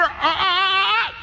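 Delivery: high, slow uh-uh uh-uh; high warning uh-uh. Retold or imitated. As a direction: cut them off with a sing-song uh-uh. Cutting off forbidden word.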